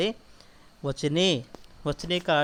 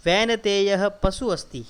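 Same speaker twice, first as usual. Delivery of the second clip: recitation; 3 semitones higher, 4 dB louder, slower than usual. Shown as a demonstration. Teacher reciting Sanskrit sentence.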